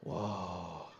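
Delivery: low, soft drawn-out "whoa"; taken aback. Retold or imitated. Comedian retells his shock at her message, stunned and hushed. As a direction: let a quiet, low whoa sink out.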